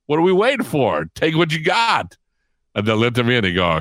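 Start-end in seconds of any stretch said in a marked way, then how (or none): 0.1-2.2 high-pitched rallying cry, slowing at the end; Mikey. Retold or imitated. Kid at the treasure table urges friends, rallying call. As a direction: urge the gang to dig in, pitched up. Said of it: "Mikey goes".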